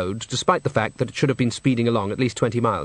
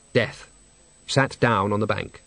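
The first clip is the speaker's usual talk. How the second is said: usual speaking voice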